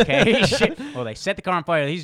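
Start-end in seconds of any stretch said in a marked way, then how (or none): 0.0-0.8 loud, high laughing reaction; cackling, incredulous okay; laughing disbelief. Retold or imitated. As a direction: burst out laughing while quoting a stunned reaction. Laughing at retold Molotov moment.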